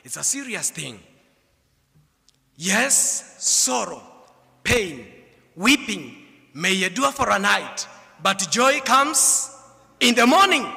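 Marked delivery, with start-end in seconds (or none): 2.6-10.7 preaching cadence. Acted. Preacher quotes scripture.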